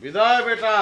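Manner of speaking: stage-king goodbye; jatra theatre. Pretend. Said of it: "যাত্রার ঢঙে কথা কও কেন".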